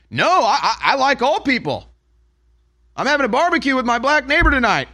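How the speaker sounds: high, hurried fake-earnest pleading; mock flustered defence. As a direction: overplay a nervous excuse.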